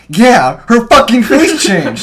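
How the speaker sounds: slow, loud sarcastic echo; high, exasperated imitation of friend; retold angry shout. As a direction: yell it back at the talker. Imitates friend snapping at girl.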